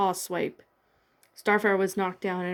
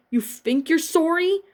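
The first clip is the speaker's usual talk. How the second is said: higher, louder angry retort; yelling; 8 semitones higher, 3 dB louder than usual. A narrator's reading. Raven yelling back at Starfire, higher angry retort. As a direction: throw the question back at her, angry. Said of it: "Raven yelled at Starfire".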